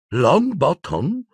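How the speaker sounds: high, slow, rising question; malicious high-voiced woman; wicked witch. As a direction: repeat the name slowly with relish. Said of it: "ripeté Bellatrix".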